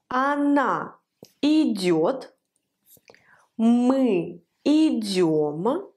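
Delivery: very slow, elongated words with pauses; slow repeat-after-me recitation. Shown as a demonstration. Teacher reciting the conjugation together with learners, slow and measured. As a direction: lead the class through it, stretching every word. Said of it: "Prononçons la conjugaison ensemble".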